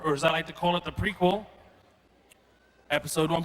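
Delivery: ordinary delivery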